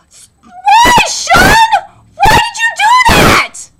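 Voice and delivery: high, loud hammed-up crying wail; mock wail. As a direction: wail at the screen like a heartbroken fan. Reacting to friend quitting sumo.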